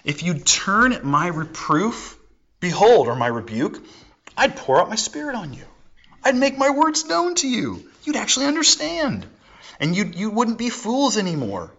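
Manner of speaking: high gentle call of Wisdom offering her spirit; Wisdom's voice. Retold or imitated. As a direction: lift the voice high like Wisdom inviting. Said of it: "hear her voice".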